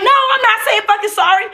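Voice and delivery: heated refusal. Retold or imitated. Refusing to apologize to the cousin, heated. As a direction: fire it back defiantly, quick. I actually said that out my mouth.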